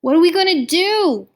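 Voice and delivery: high, loud desperate cry for help; tearful desperation. A narrator's reading. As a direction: wail it helplessly, near tears. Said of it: "I was crying by then".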